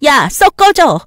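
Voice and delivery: 점점 커지는 화난 고함; rising angry shout ending in get lost; furious dismissal. Acted. Wife drives friend away. (불륜 친구를 문 앞에서 쫓아내며 화내는 고함)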